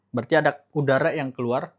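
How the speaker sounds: usual speaking voice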